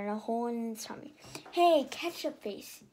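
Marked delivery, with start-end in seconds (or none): 1.5-2.8 grumpy. A narrator's reading. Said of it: "he said grumpily".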